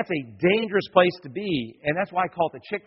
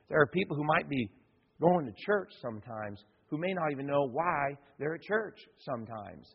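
usual speaking voice

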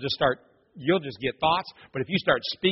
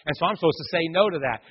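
ordinary delivery

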